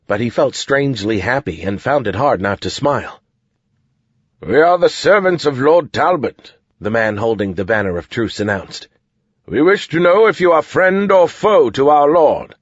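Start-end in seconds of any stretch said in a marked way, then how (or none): 4.4-6.5 raised, formal herald voice; soldier herald. A narrator's reading. 9.5-12.5 raised, formal challenge; soldier herald. A narrator's reading.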